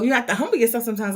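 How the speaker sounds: ordinary delivery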